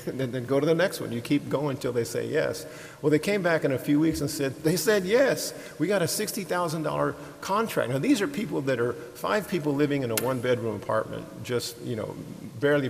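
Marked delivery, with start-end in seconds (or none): 4.6-7.9 higher-pitched mock triumph; retold excited announcement. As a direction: report the good news with mild glee. Retells couple announcing their success.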